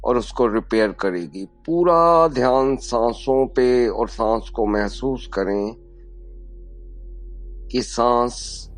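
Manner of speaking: calm guidance, slowing toward the end; guided meditation. Acted. Yoga teacher guiding breath to the spine, slowing meditative voice.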